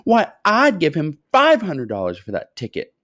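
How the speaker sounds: high, loud indignant woman; shout. A narrator's reading. A woman in the crowd shouting her bigger offer, high and loud. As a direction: cry out in disbelief. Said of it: "shouted a woman".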